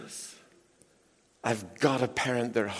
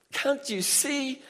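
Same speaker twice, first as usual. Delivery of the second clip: wife's words in a much higher pitch; 9 semitones higher than usual. Retold or imitated. Preacher voicing the wife in a high voice. Wife's quote in a sermon story.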